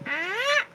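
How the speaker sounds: very high little raccoon cry; raccoon squeal. Acted. Game master as raccoon greeting August, high squeal. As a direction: squeal like a small friendly animal. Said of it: "And he goes".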